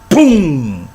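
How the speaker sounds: sound effect. Retold or imitated. Retelling being punched.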